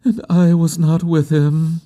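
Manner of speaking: high-pitched, plaintive lament; grieving woman's murmur. A narrator's reading. Woman regretting she was absent at his death, high and plaintive. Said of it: "she murmured".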